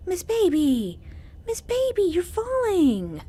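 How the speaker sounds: real alarm as the cat falls; alarmed call to cat. Real, not acted. Cat slipping off her lap.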